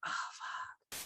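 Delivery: breathy unvoiced whisper of dismay; whispered curse. First seeing the puppy photo, a whispered curse. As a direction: mutter it under your breath, stunned.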